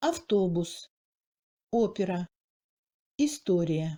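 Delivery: autobus, opéra, historia with stressed O relaxing at its end; delabialised Russian O. Shown as a demonstration. Teacher gives examples of Russian accented O, delabialised. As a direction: let the lips loosen through the O in opéra. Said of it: "Le O se délabialise vers la fin".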